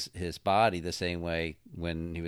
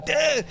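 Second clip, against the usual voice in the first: sudden high yelp of a jolt; imitated robot zap yelp; 8 dB louder than usual. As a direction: yelp like getting zapped. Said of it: "you kinda hear him go".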